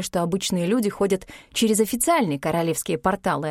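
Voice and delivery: ordinary delivery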